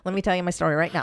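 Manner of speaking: hurried, caffeinated rush; talking really fast. Shown as a demonstration. Demonstrates coffee's effect on her, talking really fast. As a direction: race through it at double speed. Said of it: "talking really, really fast".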